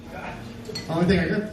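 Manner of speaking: normal talking voice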